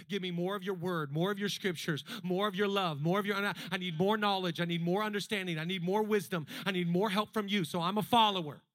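building prayer. Acted. Pastor's prayer grows into an urgent loud plea. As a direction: let the plea swell with each line.

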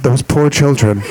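lightly voiced slow pitying murmur; a hint of sad pity; mock pity. Audience reacting to the parents' marriage, mild pity. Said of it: "you guys all went".

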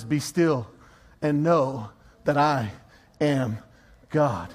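low, slow, hushed scripture quote; still, measured voice of God; calm. Acted. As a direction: let each phrase settle with long pauses. Quoting God's call to be still.

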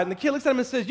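ordinary talk, nothing marked

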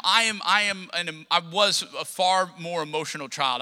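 usual speaking voice

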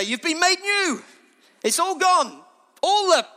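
high, loud preaching cry; exultant proclamation. Real, not acted. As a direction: proclaim the good news at full force.

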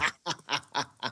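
staccato laugh in a run of short bursts; real-or-fake laugh. Real, not acted. Co-host answering "how are you", possibly fake staccato laugh. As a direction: laugh in separate, even ha-ha beats. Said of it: "if it's a real laugh or a fake laugh".

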